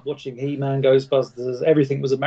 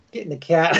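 an attempt at Boston accent; lightly put-on South Boston accent; 2 semitones higher than usual. Shown as a demonstration. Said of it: "can you do a Boston accent".

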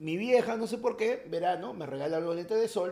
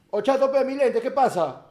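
louder, higher indignant demand; mock confronting; 7 semitones higher, 9 dB louder than usual. Demanding his glasses back from three guys, loud indignant. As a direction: square up to the thieves.

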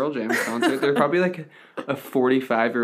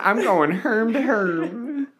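high mock voice; 8 semitones higher than usual. Joke voice repeating Herm.

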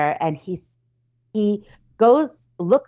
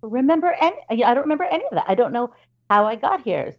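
bewildered; 8 semitones higher than usual. Retold or imitated. Confused waking brother.

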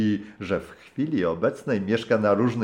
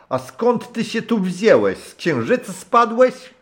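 high, loud bellow like a corporal at a private; roaring old man; 13 semitones higher, 6 dB louder than usual. Acted. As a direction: roar at the newcomer in a put-on old voice. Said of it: "Ryknął na mnie jak kapral". (ryk starego kapitana, głośno i wysoko)

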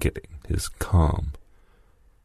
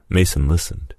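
plain reading voice

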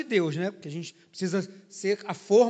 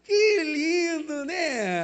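slow, high, louder mock admiration; sarcastic praise; 6 semitones higher, 4 dB louder, much slower than usual. Pastor mocking the funk singer's video, sarcastic. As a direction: drawl it out in sarcastic wonder.

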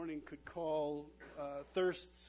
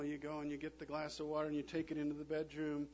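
ordinary talk

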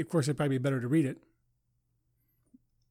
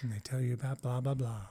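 lightly imitating a soothing podcaster, quieter; soft podcast voice; 5 semitones lower, 7 dB quieter than usual. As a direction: lower and softer, mocking smooth podcast host. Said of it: "When they talk like this".

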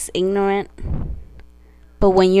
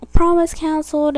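mocking whiny; 7 semitones higher, 5 dB louder than usual. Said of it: "are saying".

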